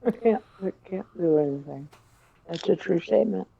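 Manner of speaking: talking while munching popcorn. Real, not acted. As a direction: mouth full of popcorn. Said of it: "Sorry, I'm eating popcorn".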